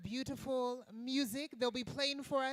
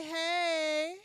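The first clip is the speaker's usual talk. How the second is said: call-and-response cue; 5 semitones higher, 6 dB louder than usual. Shown as a demonstration. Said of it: "let me hear you say".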